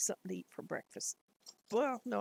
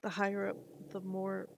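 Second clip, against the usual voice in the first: ordinary talk, nothing marked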